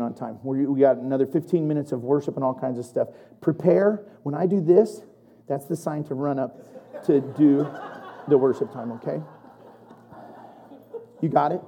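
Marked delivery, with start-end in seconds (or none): none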